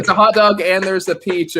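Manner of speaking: high, loud, excited exclamation. Real, not acted. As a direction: call it out excitedly. Excited reaction to sticker.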